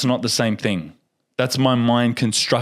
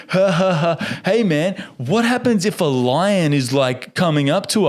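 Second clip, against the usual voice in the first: carefree mock tourist; 6 semitones higher than usual.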